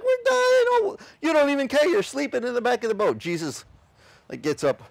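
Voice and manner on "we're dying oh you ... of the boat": high, loud mock panic; panicked disciples. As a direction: whine in terror at Jesus. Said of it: "the disciples were like".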